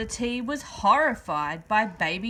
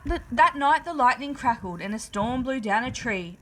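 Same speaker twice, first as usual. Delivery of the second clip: plain reading voice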